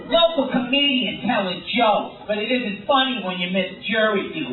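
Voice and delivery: hammed-up crime show narration; angry TV narrator. Crime show narrator mocking a comedian who missed jury duty, hammed-up indignation. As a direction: narrate it like an irritating, judgmental TV host. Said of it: "angry guy narrating my story".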